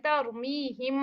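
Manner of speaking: तरुमीहिम with the lips lightly joined on मीम, not squeezed; lips closed, not pressed. Shown as a demonstration. Teacher showing students how to close the lips without pressing. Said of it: "याद रखें दबाना नहीं है".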